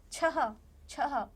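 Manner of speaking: छह with a long, breathy ह at the end, not plain छ; slow breathy छह. Shown as a demonstration. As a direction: stretch छह slowly, letting the breath of अह out. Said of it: "छ नहीं बोल रहे हम उसको". (छह धीरे, अंत में साँस वाली ह)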